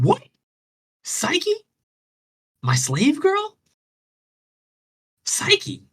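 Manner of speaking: outraged shriek. Retold or imitated. Venus shrieking at the seagull's news. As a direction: squeal the name in furious disbelief. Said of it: "So she's pissed".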